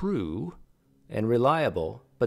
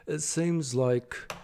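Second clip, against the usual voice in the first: put-on slow, higher-pitched stab at a British accent; British accent attempt; 5 semitones higher, much slower than usual. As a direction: try a British accent and fail. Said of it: "I can't do a British accent".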